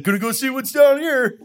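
high shouted read of a text; mock shout. Reading out his friend's text, a high shout. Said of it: "you sent me".

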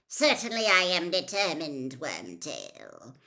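cold master's threatening reply; cold menace. A narrator's reading. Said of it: "There was a note of menace in the cold voice now".

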